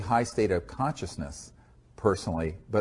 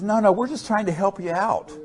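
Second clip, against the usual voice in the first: much higher, put-on innocent voice; mock innocent recruiter; 11 semitones higher, 4 dB louder than usual. The recruiter brushing off the refusal, in a mock innocent high voice. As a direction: play the man all wide-eyed and helpful. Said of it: "He says".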